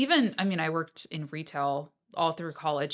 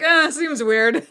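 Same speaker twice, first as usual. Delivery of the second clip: slow, louder, higher, laughing; skeptical dismissal; 8 semitones higher, 10 dB louder, slower than usual. Retold or imitated. To her friend about the app, laughing dismissively. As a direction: brush it off while laughing. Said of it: "I was like".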